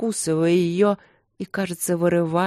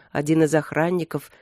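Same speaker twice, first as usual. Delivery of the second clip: ordinary delivery, nothing marked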